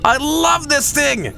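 high, slow, drawn-out delight; ecstatic. Real, not acted. Gamer loves the new find, ecstatic. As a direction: stretch "love" high with joy.

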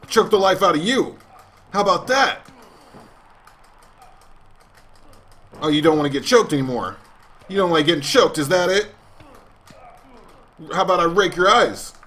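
gloating taunt. Pretend. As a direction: taunt him like a cocky heel.

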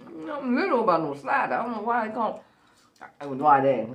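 talking while eating; chewing with hand at mouth; mouth full of fries. Real, not acted. Seen 1: Hand pushes fries into mouth while she speaks throughout.

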